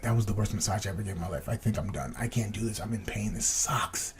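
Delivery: softly complaining to a friend at the back of the room; defeated mutter. Retold or imitated. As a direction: mumble it like you're ready to quit. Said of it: "and I was just like".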